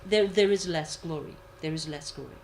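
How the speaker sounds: ordinary talk, nothing marked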